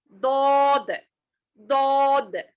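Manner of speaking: दौद with a thick, full-mouthed ḍ; heavy daud. Shown as a demonstration. Teacher showing the heavy दौद to students. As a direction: fill the mouth on दौद without rounding the lips. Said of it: "मोटी आवाज से पढ़ेंगे".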